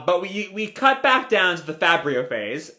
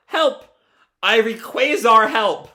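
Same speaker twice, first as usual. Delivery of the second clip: high-pitched hammed-up plea; cry for help; 6 semitones higher, 4 dB louder than usual. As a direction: call out for rescue. Stuck scientist cries for help.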